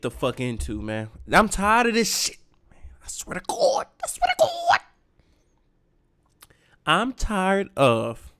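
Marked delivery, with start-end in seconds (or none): 1.3-3.9 breathy high whisper of despair; whispered exasperation; mock despair. 4.0-4.8 very high mock wail; mock cry. Exasperated mock despair. 6.9-8.3 very slow, stretched complaint; drawn out. Real, not acted.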